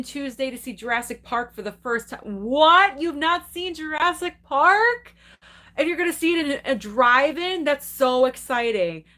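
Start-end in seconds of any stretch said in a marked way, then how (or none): 2.4-5.1 much higher, louder, stretched out; shocked surprise. Real, not acted. To Kevin, who has never seen Jurassic Park, shocked surprise. 5.8-9.0 excited for him. Real, not acted. Thrilled for viewer's drive-in plan.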